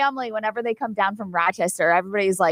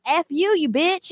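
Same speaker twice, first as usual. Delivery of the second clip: mock insult; 6 semitones higher, slower than usual. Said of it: "you could be like".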